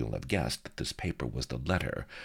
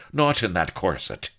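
louder, higher plea; pleading; 5 semitones higher, 5 dB louder than usual. A narrator's reading. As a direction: implore her, pitched up. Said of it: "he pleaded".

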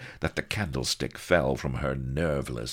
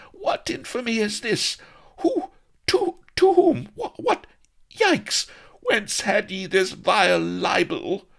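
stammering, very high-pitched yowl; shrill outrage; 7 dB louder than usual. A narrator's reading. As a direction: shriek in rage and dismay. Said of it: "yowled with rage and dismay".

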